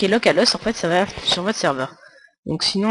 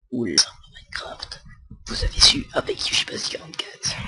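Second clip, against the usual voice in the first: breathy whispered aside; 7 semitones lower than usual. Acted. Tutorial host whispering his channel name to viewers, whispered. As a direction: drop to a secret whisper.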